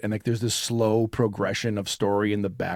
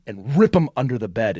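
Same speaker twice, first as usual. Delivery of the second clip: ordinary talk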